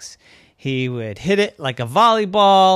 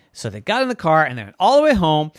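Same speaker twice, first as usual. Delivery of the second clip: usual speaking voice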